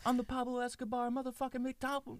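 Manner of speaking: very high, strained comic proclamation; mock grandiose boast. Crook boasting from his mansion balcony, mock grandiosity. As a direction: proclaim it in a squeaky put-on holler.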